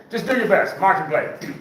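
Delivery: normal talking voice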